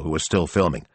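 ordinary delivery, nothing marked

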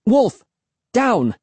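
high, sharp dog commands; shouted command. A narrator's reading. Narrator ordering the dog off, high shout. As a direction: shout each word separately at an unruly dog. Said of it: "I shouted".

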